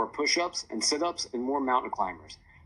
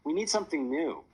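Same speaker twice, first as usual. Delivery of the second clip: higher-pitched, mildly groaned complaint; a touch of groaning, pitched up; 7 semitones higher than usual. A narrator's reading. Bored daughter complaining to the family, mild groan. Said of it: "Sophia groaned".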